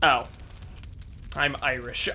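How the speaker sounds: ordinary delivery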